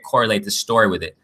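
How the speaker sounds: ordinary talk, nothing marked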